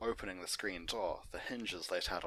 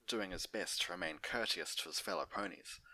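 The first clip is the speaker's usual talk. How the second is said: plain reading voice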